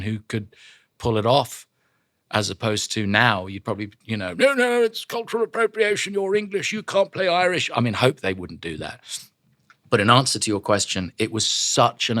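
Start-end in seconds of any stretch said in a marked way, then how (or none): 4.3-7.7 high-pitched scolding objector voice; mock objector. Imagined critic objecting to an English actor playing Irish, mock outrage. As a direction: protest in a high, put-on voice.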